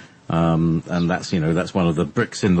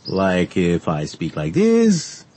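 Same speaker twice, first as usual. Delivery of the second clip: slow, drawn-out rhythm; slow rhythm demo; much slower than usual. Shown as a demonstration. Demonstrating accent rhythm to co-host, slow and drawn out. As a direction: stretch each word out slowly to show the rhythm. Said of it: "The accent is rhythm".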